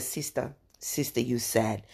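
soft, hushed African auntie voice; African accent. Retold or imitated. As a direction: lean in gently with an African lilt. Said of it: "She said".

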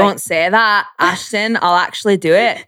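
put-on botched British accent sliding into Scottish; overdone Scottish-sounding imitation; super Scottish accent. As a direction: mimic his British accent but land on Scottish. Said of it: "that was super Scottish".

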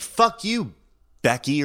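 high snapping yell; a light mock of the angry attacker; angry retort. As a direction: hurl it back at her. Attacker snaps at woman.

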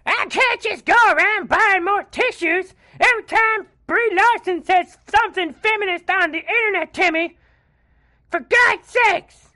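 very high, loud scolding mother; exasperated mom voice. Acted. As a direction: nag like a fed-up mom. Mother scolding son Timmy.

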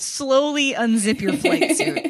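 playful mock British accent, laughing. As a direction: put on a slow British accent through laughter. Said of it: "but with a British accent".

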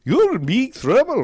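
slow, high, loud mock-Scottish yell; Scottish accent. Official threatening runners from truck, bad Scottish accent. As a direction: bellow it in hammed-up Scottish. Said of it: "I guess he's Scottish".

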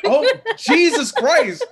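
high, loud and slow, half laughing; panicked exclamation. Retold or imitated. Caught cursing in front of his in-laws, high laughing panic.

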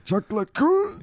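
put-on old grandpa voice. Echoing the grandpa voice.

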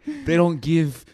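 halting, lightly put-on Canadian accent; Spinner, Canadian voice. TV teen answering his friend about medical weed, bad Canadian accent. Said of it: "It's like your Canadian voice".

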